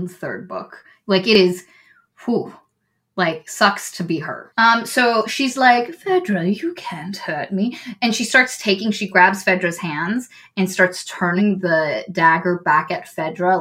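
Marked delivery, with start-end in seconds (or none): 5.9-7.8 menacing whisper. Retold or imitated. Villain taunting at dagger point.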